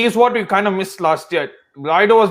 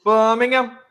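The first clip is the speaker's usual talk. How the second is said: higher, stretched try at Brummie; attempted Birmingham accent; 5 semitones higher, slower than usual. Shown as a demonstration. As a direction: copy the longer Brummie Birmingham. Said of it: "No, longer".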